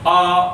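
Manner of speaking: आ said with the mouth wide open; open-mouth vowel. Shown as a demonstration. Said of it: "तो पूरा मुंह खुल गया".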